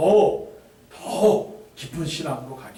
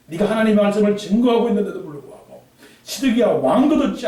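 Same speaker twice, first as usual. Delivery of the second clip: God consoling Jeremiah; 7 semitones higher, 6 dB louder than usual. Acted.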